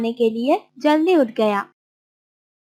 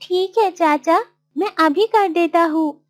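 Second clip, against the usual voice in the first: higher, eager-to-help character voice; obliging Martin; 6 semitones higher than usual. Acted. Martin agreeing to clean uncle's garden, obliging. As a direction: answer respectfully in Martin's higher voice.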